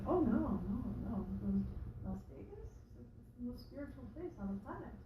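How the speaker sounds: hushed, lightly put-on Indian accent; soft, serene mock Deepak accent; Deepak Chopra accent. Quoting Deepak's Las Vegas line to Conan, hushed accent. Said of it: "Terrible Deepak accent".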